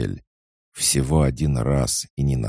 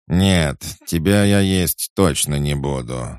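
louder, disgusted snapping back; disgusted snap; 5 semitones higher, 5 dB louder than usual. Acted. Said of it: "с отвращением огрызнулся Боря".

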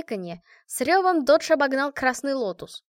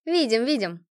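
ordinary delivery, nothing marked

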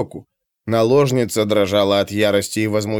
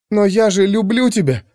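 high, wounded feminine plea; plaintive, high-voiced appeal; pleading lover; 7 semitones higher than usual. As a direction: protest love to someone rejecting you. Girl protests her love.

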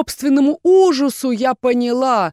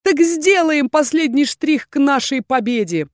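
high, loud joyful announcement; 6 semitones higher, 3 dB louder than usual. A narrator's reading. Erhan tells the team joyfully. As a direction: sing out the good news. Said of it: "радостно заявил Эрхан".

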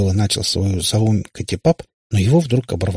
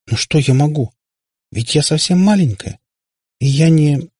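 put-on higher, faltering little girl; 7 semitones higher, 4 dB louder than usual. A narrator's reading. Katya says she is only little, faltering. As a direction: trail off, unable to finish. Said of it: "Она запнулась и замолчала".

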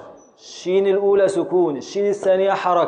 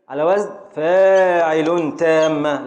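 slow scansion; 4 dB louder, much slower than usual. Shown as a demonstration. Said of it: "teacher scanning meter".

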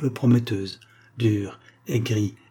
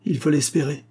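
dry retort, pitched up; 6 semitones higher than usual. A narrator's reading. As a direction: answer curtly, with a touch of pique. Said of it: "dit Agar très sèchement". (très sèchement)

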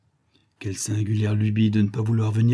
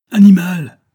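higher, much louder furious cry; shout; 8 semitones higher, 13 dB louder than usual. A narrator's reading. Uncle yelling at his nephew in alarm, a loud higher shout. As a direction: yell the insult at the nephew. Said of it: "me cria mon oncle".

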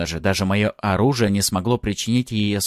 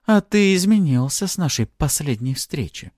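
higher, smirking remark; 7 semitones higher than usual. A narrator's reading. Artara appraising her old opponent, smirking. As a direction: say it through a smirk. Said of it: "ухмыльнулась она".

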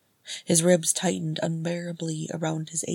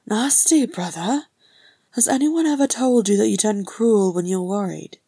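higher, louder brotherly rebuke; chiding; 4 semitones higher, 7 dB louder than usual. Acted. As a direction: call him out, unimpressed. Brother chides Thorin's cruelty.

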